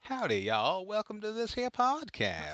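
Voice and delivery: high, drawn-out Southern welcome; Southern accent. Shown as a demonstration. Host showing off his best Southern accent, hammed-up Southern drawl. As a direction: put on your best Southern podcast greeting. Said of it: "my best Southern accent".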